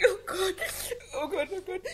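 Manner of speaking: high despairing wail. Acted. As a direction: cry out to God. Wife despairs over childlessness.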